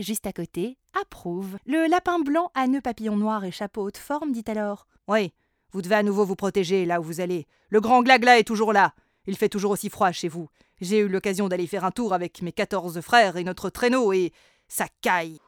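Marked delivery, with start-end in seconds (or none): 5.1-15.4 quick, loud rabbit voice; chatty rabbit. A narrator's reading. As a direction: rattle on like a busy rabbit. (lapin bavard et pressé)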